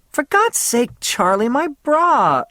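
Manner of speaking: mock-outraged woman; exasperated protest. A narrator's reading. Faye protests bra torn.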